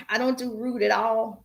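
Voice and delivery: normal talking voice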